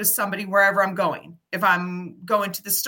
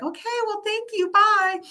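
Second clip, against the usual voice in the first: high, chirpy brush-off; lightly voiced startled shopper; quick high thanks and goodbye; polite stranger; 12 semitones higher than usual. Shoppers answering her hello.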